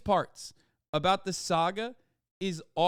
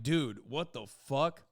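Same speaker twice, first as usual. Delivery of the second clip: mock dude voice; 3 semitones lower than usual. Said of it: "motherfuckers being like".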